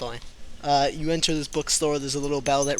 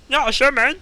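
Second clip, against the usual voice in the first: higher, louder squeaky elf; 10 semitones higher, 7 dB louder than usual. Shown as a demonstration. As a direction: pitch it up into elf. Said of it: "shouting through a pillow".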